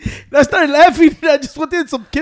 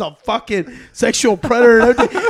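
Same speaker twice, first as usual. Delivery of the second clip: ordinary talk, nothing marked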